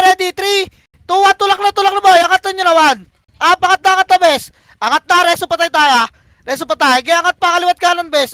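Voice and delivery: high-pitched rapid-repeat orders; urgent shot-calling. Real, not acted. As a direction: drive the team forward with urgent calls. Urgent orders to teammates mid-fight.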